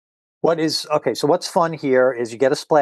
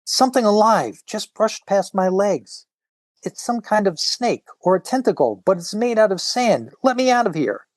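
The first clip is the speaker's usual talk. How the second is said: frightened woman; 6 semitones higher than usual. Acted. Frightened swimmer voiced.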